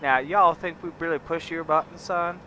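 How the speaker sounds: southern ranch talk. Acted. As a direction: lay on a big Southern accent, welcoming a partner. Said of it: "I could go all southern on you".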